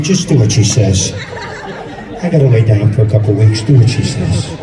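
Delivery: low, loud, brainwashed father droning; husband repeating the wife's orders, low and loud; dazed father. Retold or imitated. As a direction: mumble it like a man with his brain sucked out. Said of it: "He walks around going".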